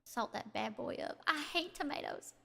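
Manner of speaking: normal talking voice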